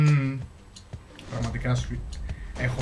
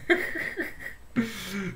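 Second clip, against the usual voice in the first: high burst of real laughter; amused laugh; 8 semitones higher than usual. Real, not acted. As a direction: crack up at your own remark. Laughs at own joke. (αυθόρμητο γέλιο, ψηλά)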